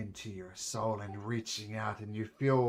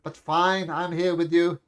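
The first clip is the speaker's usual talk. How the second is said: loud, high weary exasperation; fed-up companion grumbling at full volume, pitched up; exasperated companion; 7 semitones higher, 13 dB louder than usual. Acted. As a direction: complain loudly like a fed-up ally dragged along. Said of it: "Then says".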